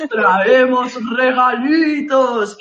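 slow, loud distorted voice; lightly mimicking a voice changer; voice distorter. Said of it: "con el distorsionador de voz".

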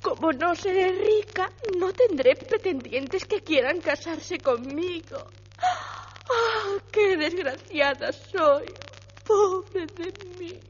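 put-on weepy girl bidding goodbye; tearful milkmaid. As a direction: sob through the farewells. Milkmaid weeps her losses. (lecherita llorosa)

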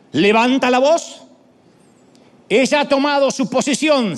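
high, loud calling voice, as a woman crying out in the street; Lady Wisdom calling out. Acted. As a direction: lift the voice high and call to the crowd. (la señora sabiduría llamando a voz en cuello, aguda y fuerte)

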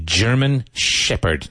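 normal talking voice

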